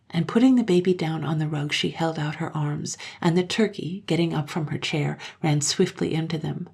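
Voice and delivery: ordinary delivery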